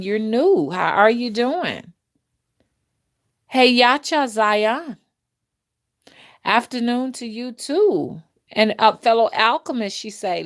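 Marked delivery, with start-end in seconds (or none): none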